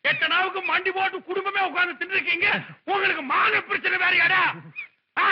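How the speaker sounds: high-pitched mocking outrage; indignant scolding. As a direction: throw his word back at him, scandalised. Berating guest over tiny gift.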